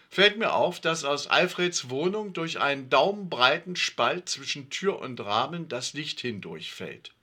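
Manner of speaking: usual speaking voice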